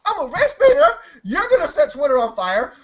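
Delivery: high, loud hammed-up Oprah squeal; mock Oprah. Host Oprah jumping out of her seat, shrieking mock outrage. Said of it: "She's like".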